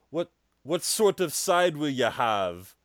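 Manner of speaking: slow imitation Jamaican accent. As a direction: take the order in a put-on Jamaican lilt. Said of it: "maybe it's more of a Jamaican style".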